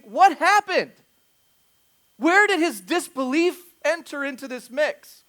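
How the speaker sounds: loud, high-pitched preacher delivery; forceful preaching. Acted. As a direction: drive the point home to the congregation.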